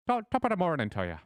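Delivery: high, loud stage-Irish shopkeeper; a hammy Irish weapon seller greeting customers; bad Irish accent. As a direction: ham up a cheery Irish brogue. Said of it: "Any Irish people, I deeply apologize".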